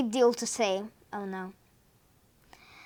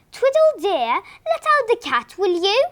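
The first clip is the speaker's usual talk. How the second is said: higher sweet wifely voice; wife's voice; 6 semitones higher, 4 dB louder than usual. A narrator's reading. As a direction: coax him in a high wife voice. Wife asking husband sweetly.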